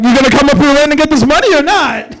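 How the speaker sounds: shouted impatient ultimatum; exasperated yell. Acted. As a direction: yell it at someone stalling. Acted exasperated callers demanding an answer.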